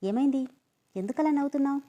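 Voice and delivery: puzzled, asking why he laughs; confused. A narrator's reading. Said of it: "అయోమయంగా".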